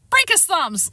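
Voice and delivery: high, gleeful cry; high-pitched delighted yell; gleeful shout. A narrator's reading. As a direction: cheer on the attack with glee. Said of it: "Lyle shouted gleefully".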